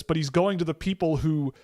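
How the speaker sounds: usual speaking voice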